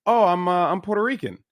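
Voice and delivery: usual speaking voice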